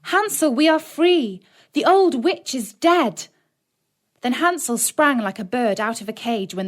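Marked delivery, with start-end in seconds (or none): 0.0-3.3 high, loud shout of joy; joyful cry. A narrator's reading. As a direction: burst out with the good news. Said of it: "and cried".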